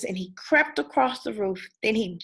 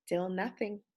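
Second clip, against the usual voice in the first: ordinary delivery, nothing marked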